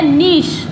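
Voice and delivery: "नीश" drawn out, ending in sh; British pronunciation. Shown as a demonstration. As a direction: say "नीश" as neesh. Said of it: "British English में इसको बोला जाता है".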